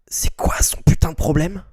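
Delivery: whispered in disgust; whispering. Real, not acted. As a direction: breathe it out in disbelief. Unvoiced, stunned.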